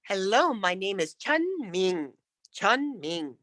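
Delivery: "Chun" held up, "Ming" stepped down; rounded, falling tune. Shown as a demonstration. Coach modelling a student's introduction, falling tune. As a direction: introduce yourself, dropping on "Ming". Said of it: "down at the last one".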